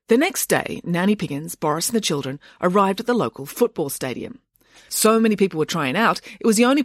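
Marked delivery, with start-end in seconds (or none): none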